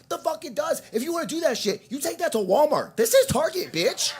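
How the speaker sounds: very high, indignant mock rant; infuriated comeback. Shopper telling the mother off in Target, very high mock fury. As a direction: climb into a squawking acted outrage. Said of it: "I'm fucking infuriated".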